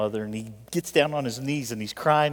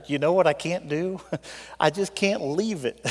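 normal talking voice

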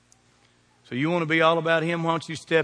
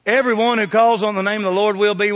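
raised, louder scripture proclamation; proclaiming; 5 semitones higher, 6 dB louder than usual. Real, not acted. Preacher quoting the Bible to his congregation, loud proclamation. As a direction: ring it out for the congregation to finish.

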